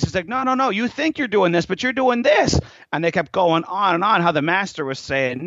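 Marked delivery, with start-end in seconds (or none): 0.3-2.6 high, insistent acted correction; contradicting. Master overruling his student, high and insistent. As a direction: overrule them with a pitched-up retort.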